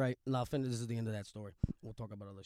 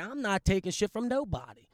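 higher, louder retold defiance; retold anger; 7 semitones higher, 6 dB louder than usual. Guy retelling his stand against bullies, angrily defiant. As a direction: put your foot down, heated. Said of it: "I'm so mad".

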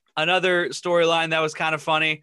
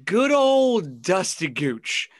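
fond name drop; 3 semitones higher, much slower than usual. Real, not acted. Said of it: "savoring the meme name".

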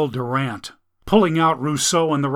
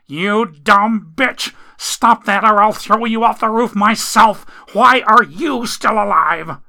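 put-on enraged man threatening his girlfriend; furious threat; 8 semitones higher, 7 dB louder than usual. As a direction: threaten her through the pain. Jack threatens her.

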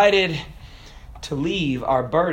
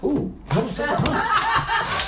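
laughing disbelief; 11 semitones higher, 5 dB quieter than usual. Retold or imitated. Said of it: "And I'm like".

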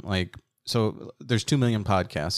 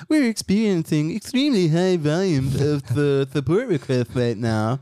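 customer support voice; 5 semitones higher than usual. Retold or imitated. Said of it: "they s-, emailed back".

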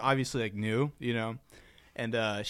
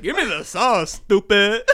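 loud, higher mock demand; brash inner voice; 5 semitones higher, 8 dB louder, slower than usual. Imagined reply to Starbucks barista, brash mock demand. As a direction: punch the last word like a catchphrase. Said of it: "But in my head, I was like".

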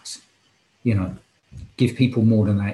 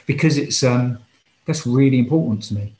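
welling up; 4 semitones higher, 5 dB louder than usual. Real, not acted. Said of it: "I'm getting slightly emotional".

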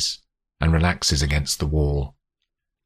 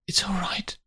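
soft, whispered reassurance; reassuring; 7 dB quieter than usual. A narrator's reading. Olivia to Grace gasping in pain, whispered reassurance. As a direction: soothe in a high, gentle whisper. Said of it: "she says reassuringly".

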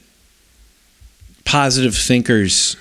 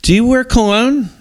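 squeaky mock question, much higher; silly high voice; 12 semitones higher, 4 dB louder than usual.

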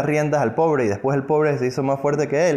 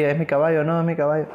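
raised voice, a touch of bickering; mock quarrel; 4 semitones higher, faster than usual. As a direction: trade claims in a playful fight. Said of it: "comienza a pelear ahí". (discusión fingida, voz algo más alta)

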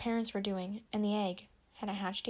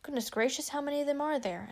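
higher-pitched, surprised call; astonished call at normal volume; 6 semitones higher than usual. A narrator's reading. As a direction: exclaim in amazement, pitched up. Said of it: "another voice called".

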